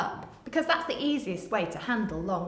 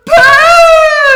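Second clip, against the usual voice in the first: big shout; 25 dB louder than usual. Acted. Teacher reading to children, shouting the big word. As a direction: shout it out much higher and louder.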